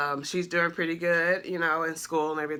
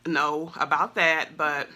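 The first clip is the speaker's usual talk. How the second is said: ordinary talk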